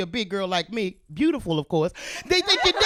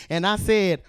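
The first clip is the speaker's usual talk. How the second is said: normal talking voice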